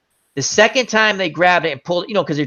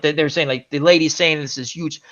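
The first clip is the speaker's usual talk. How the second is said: ordinary talk, nothing marked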